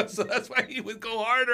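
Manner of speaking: high, laughing through the words; can't stop laughing at the punchline. Real, not acted. Coach laughing as he tells the relax joke to hosts.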